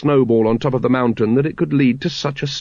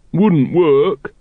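dourly; 5 semitones higher, 4 dB louder than usual. A narrator's reading. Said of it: "said Brother Watchtower dourly".

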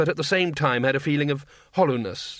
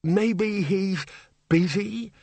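lightly voiced nervous bursar, higher; a timid wizard quavers a hopeful guess; quavering bursar; 7 semitones higher, much slower than usual. A narrator's reading. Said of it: "Eventually the bursar quavered".

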